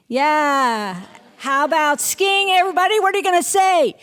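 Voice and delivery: loud, high call to the whole class; excited teacher shouting encouragement. Real, not acted. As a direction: raise the voice high across the room. Calling out to the class.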